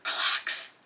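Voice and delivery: hushed unvoiced self-calming; soft whispered relax. Real, not acted. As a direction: whisper to calm yourself. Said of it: "Chill out".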